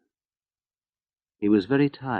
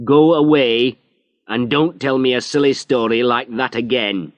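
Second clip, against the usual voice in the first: louder, higher, sharp order; stern dismissal; 5 semitones higher, 7 dB louder than usual. Acted. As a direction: send him out of the office. Inspector dismisses Harry angrily.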